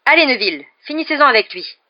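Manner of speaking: high, loud encouragement; urging on. A narrator's reading. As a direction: spur the pupil to finish it. Teacher urging a pupil on. (encouragement pressant, haut et fort)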